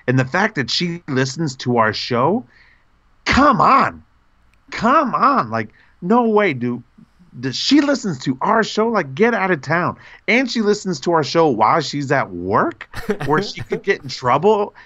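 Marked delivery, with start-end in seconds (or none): 3.2-4.0 can't believe it, excited; disbelieving. Real, not acted. Disbelief fan listens. 4.7-5.5 stunned delight; disbelieving. Real, not acted. Disbelief fan listens. 5.5-14.7 higher-pitched, gushing delight; thrilled it's true; excited disbelief. Real, not acted.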